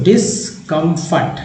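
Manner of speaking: slow "discomfort" in three separate chunks; split into parts. Shown as a demonstration. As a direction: sound out "discomfort" part by part. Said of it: "डिस एक part है".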